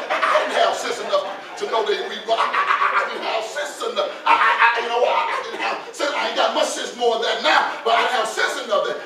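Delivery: loud, excited pulpit storytelling; worked-up preaching. Acted. Preacher telling the church a childhood mistake, worked-up preaching. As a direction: raise the voice and stumble over words in excitement.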